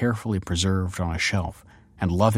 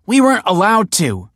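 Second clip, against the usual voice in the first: higher, louder sharp reply; sharp retort; 12 semitones higher, 8 dB louder than usual. A narrator's reading. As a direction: shoot it back. Daughter retorts.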